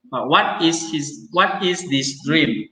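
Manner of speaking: "what is this dream" said slowly with a falling "what"; slow translation. Shown as a demonstration. Teacher reading a translation slowly to the class. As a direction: read the translation deliberately. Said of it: "translation read slowly".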